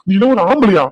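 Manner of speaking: loud contemptuous challenge; angry father. Acted. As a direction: shame him to his face. Father confronting son-in-law.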